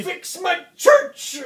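high, drawn-out furious cry; mock holy rage. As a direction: rant like a hammed-up zealot. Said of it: "flies into a burning religious rage".

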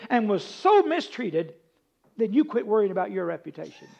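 loud, building pulpit delivery, slowing at the end; fervent preaching. Acted. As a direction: preach it to the congregation. Preaching at full force.

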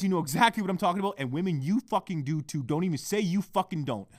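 ordinary talk, nothing marked

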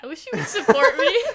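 laughing. Real, not acted. Mock complaint while laughing.

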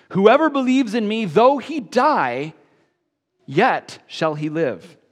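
slow, soaring preacher's read; preached scripture reading. Acted.

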